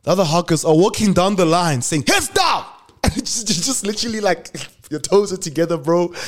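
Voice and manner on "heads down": high shouted command; barked order. Retold or imitated. Prefects walking down the line, shouting the order. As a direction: bark it at the first years in line.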